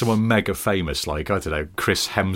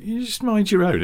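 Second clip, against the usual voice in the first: much higher mock-indignant voice; retold indignation. Wound up by a car advert, high indignant retort. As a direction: pitch it right up, indignant. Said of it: "and I was like".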